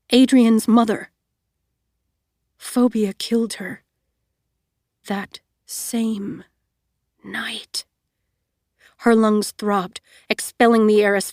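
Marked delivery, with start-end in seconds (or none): 2.6-7.8 fading into a whisper on night; hushed dread. A narrator's reading. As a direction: let the words die to a whisper. Dreadful realization.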